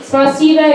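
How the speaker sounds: spasiba said the Russian way, stressed spa-SEE-ba. Shown as a demonstration. Comic introducing a Russian word, says spasiba with Russian sounds. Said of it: "that means thank you in Russian".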